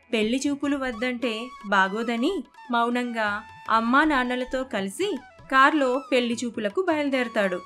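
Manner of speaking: plain reading voice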